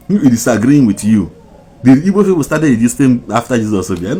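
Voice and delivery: loud, high, challenging; angry loud. Real, not acted. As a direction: demand it angrily. Heated rant.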